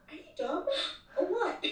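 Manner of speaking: half-joking scoff at someone dumb; mock exasperation. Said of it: "the most I would say is".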